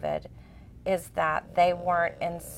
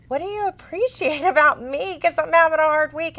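higher, louder, laughing it off; laughing self-mocking question; 8 semitones higher, 6 dB louder than usual. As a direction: laugh at your own hard week. Retells own laughing reply.

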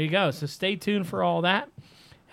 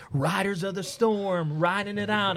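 slow, higher mock-heroic boast; sneering at the posters who brag about riding out the storm; mocking storm riders; 4 semitones higher than usual. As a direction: ham up their bravado, slowly. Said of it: "they're like".

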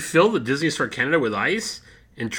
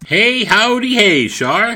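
louder hammed-up howdy; loud cheery greeting; 5 semitones higher, 7 dB louder, slower than usual. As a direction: belt the greeting.

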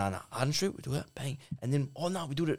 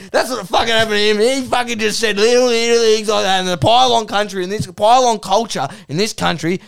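loud, high, aggressive Aussie tirade; Duck; 6 semitones higher, 6 dB louder than usual. Acted. Duck defends his good mate Eddie, loudly outraged. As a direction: burst in shouting and keep ranting. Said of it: "aggressive Australian male".

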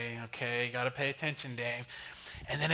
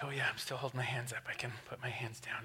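quick, soft near-whisper; hushed self-talk; 4 semitones lower, 8 dB quieter, faster than usual. Retold or imitated. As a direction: murmur a dawning realization to yourself. Acted thought while slowly calming down.

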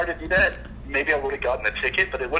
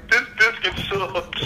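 mock entitled complaint; 7 semitones higher, slower than usual. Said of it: "underage kid to be like".

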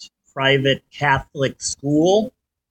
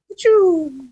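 rocket whoosh sound; 10 semitones higher than usual. Retold or imitated. Toy rocket sound effect.

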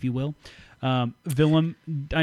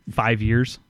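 usual speaking voice

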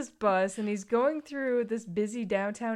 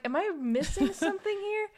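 confused; 9 semitones higher than usual. Retold or imitated. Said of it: "I was like".

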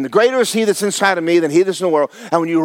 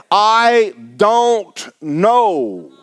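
slow drawn-out refrain; much slower than usual. Acted. Preacher to the church, drawn-out refrain. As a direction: stretch I don't know very slowly.

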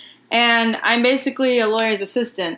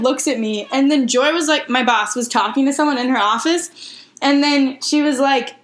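ordinary delivery

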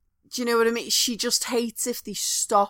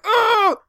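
mock outraged cry; 12 semitones higher, 8 dB louder than usual. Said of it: "and like".

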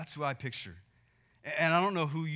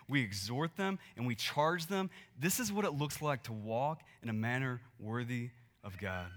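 ordinary talk, nothing marked

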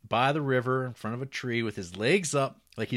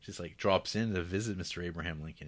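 ordinary talk

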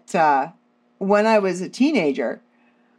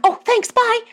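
much higher, louder chirpy mock mom voice; breezy mom; 4 dB louder than usual. Mom hanging up on her daughter, high chirpy mock voice. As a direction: toss it off like a breezy, unbothered mother. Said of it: "She's like".

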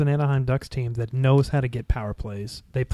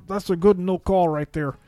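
higher-pitched mock commentator; put-on analyst voice, pitched up; Joe Micheletti; 6 semitones higher, 3 dB louder, slower than usual. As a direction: quote a TV analyst in a higher, louder, slower voice. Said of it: "Joe Micheletti".

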